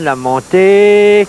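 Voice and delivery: long stretched-out excited call; drawn-out call. Real, not acted. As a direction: stretch it as the ball climbs. Putt rising toward the hole. (appel étiré et excité)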